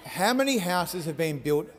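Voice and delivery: ordinary talk, nothing marked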